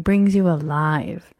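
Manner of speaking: usual speaking voice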